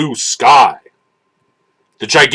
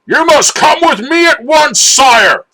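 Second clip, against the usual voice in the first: urgent shout; 13 semitones higher than usual. A narrator's reading. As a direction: shout for the prince to come now. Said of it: "shouted Man-at-Arms in a voice that echoed".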